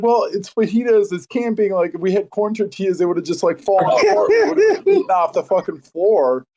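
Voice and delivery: high-pitched defensive excuse; mock-indignant self-justification; retold protest. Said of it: "I was like".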